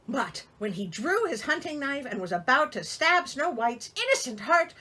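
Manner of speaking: ordinary talk, nothing marked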